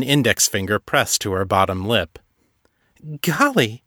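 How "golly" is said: high, breathless starlet gasp; high-pitched ingenue voice. Acted. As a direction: play the wide-eyed innocent. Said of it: "she said in a high-pitched ingenue voice".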